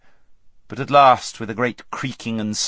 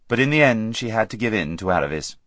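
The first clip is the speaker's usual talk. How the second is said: ordinary delivery, nothing marked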